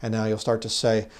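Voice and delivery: ordinary talk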